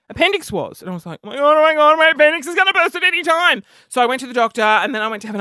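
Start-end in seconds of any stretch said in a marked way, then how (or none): 1.2-3.6 high, rushed panicky babble; mock panic. Storyteller fears appendix will burst, mock panic. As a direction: race through it in hammed-up panic. Reenacting panic over stabbing pain.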